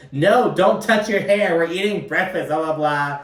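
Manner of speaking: hammed-up fussy scolding; mock freak-out. Imitating Uba flipping out.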